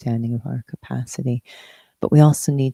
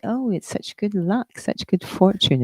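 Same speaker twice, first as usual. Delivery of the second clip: higher-pitched, lightly acted delight; mock delight; 7 semitones higher than usual. Speaker imitating people who put good events down to luck, mock delight. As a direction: exclaim it like someone marvelling at luck.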